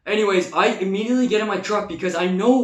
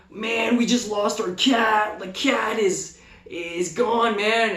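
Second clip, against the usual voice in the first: slow stoner voice; 4 semitones higher, slower than usual. Retold or imitated. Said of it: "they would be like".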